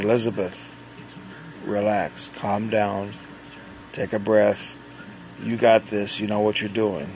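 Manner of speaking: soft, steadying voice; quiet, measured encouragement; calm reassurance. Retold or imitated. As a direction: talk a flustered helper down. Calming the flustered tech.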